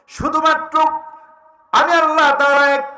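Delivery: high, loud preaching cry; thundering proclamation. Acted. Preacher voicing God's announcement to the believer, proclaimed. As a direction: ring it out as a declaration.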